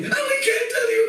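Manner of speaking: high, taunting sing-song; teasing boys. Acted. Cowherd boys taunting Krishna in a high voice. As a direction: tease the little boy from up high.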